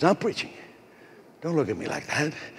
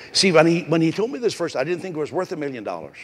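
ordinary talk, nothing marked

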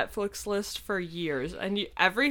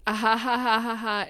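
sarcastic fake laugh; 5 semitones higher than usual.

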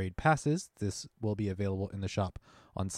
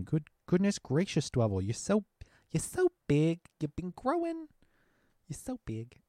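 put-on high squealing baby talk; squealing baby talk aunt; 7 semitones higher than usual. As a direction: coo like a doting aunt. Cooing at a big Dwebble.

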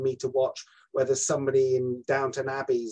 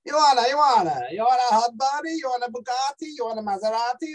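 high, singsong Italian accent; quick-fire Italian lilt, pitched up; 9 semitones higher, 3 dB louder than usual. Shown as a demonstration. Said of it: "your first accent is Italian".

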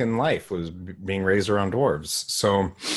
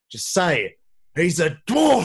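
rising to a higher, louder hammed-up shout; mock intense yell; 9 semitones higher, 5 dB louder than usual. Husband yelling at wife, mock intense. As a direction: build it into a shout. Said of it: "how intense Matthew McConaughey is getting".

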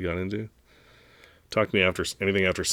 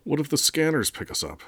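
high-pitched worried-question read; exclaimed story dialogue, voice lifted; 9 semitones higher than usual. A narrator's reading. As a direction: pitch the question well up as a character. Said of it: "Koss exclaimed".

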